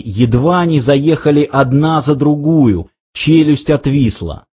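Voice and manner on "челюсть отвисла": usual speaking voice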